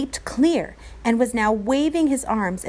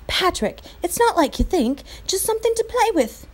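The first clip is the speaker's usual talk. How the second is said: put-on boy voice, pleading; 9 semitones higher than usual. A narrator's reading. Patrick pleading with Omri.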